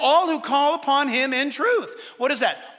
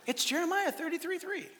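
higher, soft imitated child; little kid; 6 semitones higher, 9 dB quieter than usual. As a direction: pipe up quietly like a small kid. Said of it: "what the little kid said".

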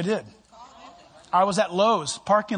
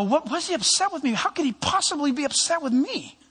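high, baffled shock at the accusation; high, stunned and baffled; shocked disbelief; 9 semitones higher than usual. Retold or imitated. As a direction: play your own stunned reaction, pitched way up. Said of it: "I go".

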